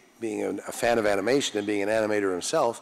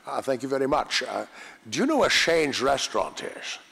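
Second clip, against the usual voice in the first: Sean Connery; 4 semitones higher than usual. Retold or imitated. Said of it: "the way James Bond says it".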